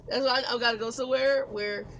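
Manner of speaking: ordinary talk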